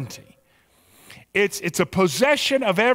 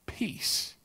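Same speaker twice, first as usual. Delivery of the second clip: whisper; 13 dB quieter than usual. Acted. Sermon ends phrase in whisper.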